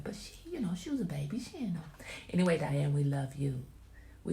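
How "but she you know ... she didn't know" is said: whispered aside; whispering. Real, not acted. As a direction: whisper it privately.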